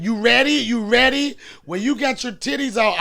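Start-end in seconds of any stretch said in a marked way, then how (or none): 0.0-1.4 raised, higher hype call; imitating Holly. Comedian imitating Holly at the club, hyped. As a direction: mimic her hyping up the club. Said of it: "When I see you saying".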